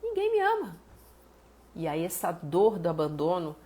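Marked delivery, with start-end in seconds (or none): 0.0-0.8 put-on higher, plaintive little baby voice rising to a wail; voicing the crying baby. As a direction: plead like a neglected baby who feels unloved. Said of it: "E o bebê sente como?". (voz de bebê carente e chorosa, bem mais aguda no final)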